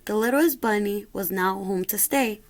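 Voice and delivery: usual speaking voice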